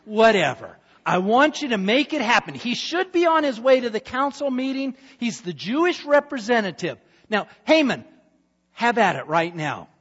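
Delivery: loud, high, dismissive king; impatient king. Acted. King ordering Haman to do it now, loud and high. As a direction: wave it off and order it done.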